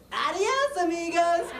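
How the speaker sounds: high, put-on sign voice; cheery road sign. Road sign bidding drivers farewell, high put-on voice. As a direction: chirp a farewell in a mock-sign voice. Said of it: "that sign that says".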